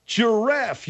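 high, exasperated snap of the word; indignant correction. Acted. Host correcting a guest's butchered giraffe, indignant. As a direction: pitch it way up in disbelief.

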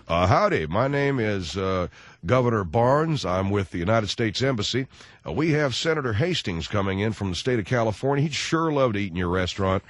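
put-on low, folksy "howdy" American accent. Embassy man booking for a senator, put-on American accent. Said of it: "affect any kind of an accent".

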